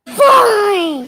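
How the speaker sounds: loud, high, drawn-out huff; mad child. Retold or imitated. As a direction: imitate a furious little kid giving in. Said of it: "so mad".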